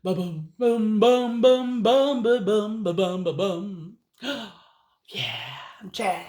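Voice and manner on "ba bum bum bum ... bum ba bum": bum-bum bass imitation; bass line. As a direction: sing the walking bass. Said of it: "Willie the worm inched up and down his bass".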